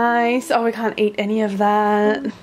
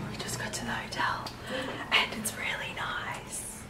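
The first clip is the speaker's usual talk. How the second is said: whispering; 14 dB quieter than usual. Real, not acted. Whispering in the hotel room.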